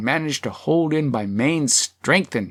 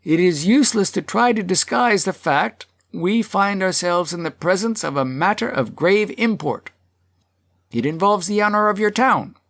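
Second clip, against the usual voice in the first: raised, formal public speech; serious address; 6 semitones higher than usual. A narrator's reading. Burgess addresses the town after the laughter, serious and raised. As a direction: declaim gravely to the whole hall, pitched up. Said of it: "get out these serious words".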